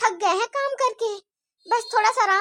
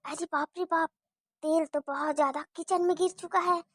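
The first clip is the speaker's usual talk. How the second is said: much softer, low sneaky muttering; conspiratorial aside; 6 semitones lower, 9 dB quieter than usual. Acted. Bahu plots to herself about the spilt oil, softer and lower. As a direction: plot under your breath. Plotting aside before mother-in-law.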